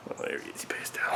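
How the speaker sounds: low, very soft murmur; hushed voice beside a dozing little one. Real, not acted. Sleepy child on his shoulder.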